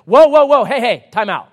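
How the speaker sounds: loud, high comic alarm; protesting chair. Pretend. Chair stops someone about to sit, comic protest. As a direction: call a halt as a playfully alarmed chair. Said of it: "your chair was like".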